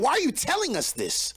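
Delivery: exasperated question. Real, not acted. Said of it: "I like how you said it".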